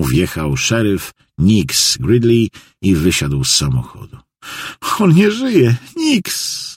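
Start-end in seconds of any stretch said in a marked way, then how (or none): 5.0-6.7 very high cry for the sheriff; distraught call. A narrator's reading. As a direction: call out in hysterical grief, high. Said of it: "zawołała na jego widok Jackie". (rozpaczliwe, bardzo wysokie wołanie)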